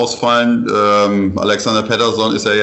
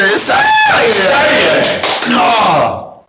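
excited cheer; 12 semitones higher, 4 dB louder, much slower than usual. Real, not acted. Celebrating a last-second block.

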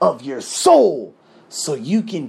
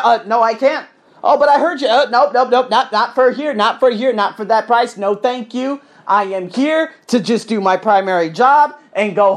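acted exchange; 6 semitones higher, 5 dB louder than usual.

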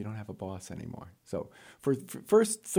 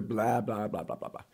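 monotonous mock droning; imitating endless talk; 3 semitones lower, 3 dB quieter than usual. Job candidate imitating the rambling interviewer, droning on. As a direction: rattle on as an interviewer who won't stop. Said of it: "he went on and on".

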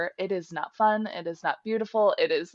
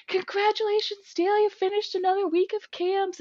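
much higher, sing-song congratulations; chirpy coworker; 13 semitones higher than usual. Retold or imitated. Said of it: "was like".

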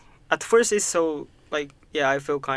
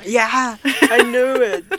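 higher and louder, laughing as she agrees; 10 semitones higher, 8 dB louder, slower than usual. Real, not acted. To a friend admitting phone panic, laughing hard. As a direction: burst out laughing in recognition.

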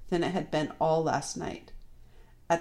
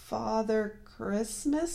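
slow, high, hesitant answer; stammering fox; 5 semitones higher, much slower than usual. A narrator's reading. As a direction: falter timidly on the words. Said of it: "stammered the fox".

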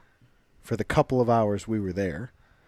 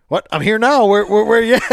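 much louder, higher, eager where-are-you; imitating eager Mackey; 8 semitones higher, 9 dB louder than usual. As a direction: call out like a friend who just rushed over. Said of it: "He goes".

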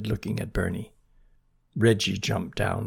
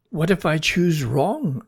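higher, louder sighing worry; 6 semitones higher, 5 dB louder than usual. A narrator's reading. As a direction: sigh out the worried question. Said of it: "asked Bernie with a sigh".

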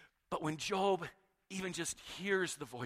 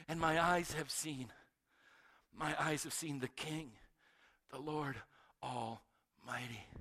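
fading, lower and softer in awe; awed hush; 4 semitones lower, 5 dB quieter, slower than usual. Acted. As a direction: let the voice sink before the King. Isaiah's awed confession.

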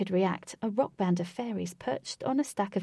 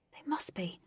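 whispering; 8 dB quieter than usual. Acted. Whispered reply.

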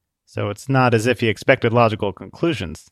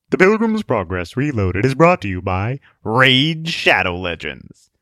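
sponsor read. Acted.

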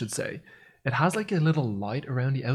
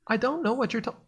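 higher, mock voice assistant; assistant's reply; 10 semitones higher, 4 dB quieter than usual. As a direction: imitate a clueless device, pitched up.

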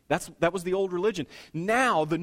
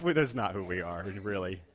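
ordinary delivery

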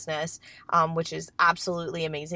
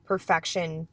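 usual speaking voice

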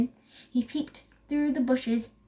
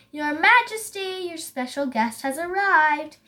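raised, louder herald's call; 4 semitones higher, 4 dB louder than usual. A narrator's reading. Someone calls to Lion from the bushes, calling out. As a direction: announce a guest to royalty. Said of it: "heard someone call, calling".

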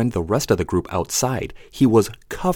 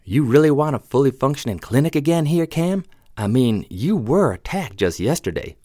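higher, anxious questioning voice; worried woman character voice; 7 semitones higher than usual. Acted. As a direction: press him with worried disbelief. Marie's lines in the story.

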